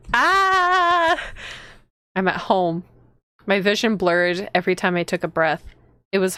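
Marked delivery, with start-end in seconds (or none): 0.1-1.2 high, sustained "aah"; alarmed scream. Real, not acted. Player reacting to the horror scene, alarmed scream. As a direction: cry out in alarm, high and held.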